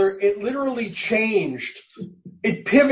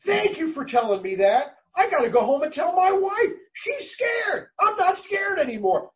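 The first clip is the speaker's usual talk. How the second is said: much higher, near-crying imitation of the driver; excited relieved driver; 9 semitones higher than usual. Driver thanking passenger, off home to his wife, high relieved cry. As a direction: gush with relief, voice cracking up high.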